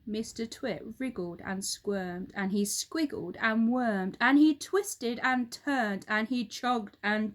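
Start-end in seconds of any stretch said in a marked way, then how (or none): none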